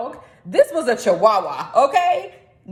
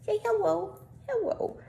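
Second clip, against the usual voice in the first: much higher, softer coo to the pet; 9 semitones higher, 8 dB quieter than usual. Real, not acted. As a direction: coo hello to your little dog. Talking to her dog on camera.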